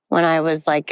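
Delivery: ordinary delivery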